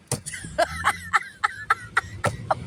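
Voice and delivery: high, breathy cackling fit; helpless laughter. Real, not acted. Storyteller losing it over what the customer said, helpless high laughter. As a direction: crack up at your own story.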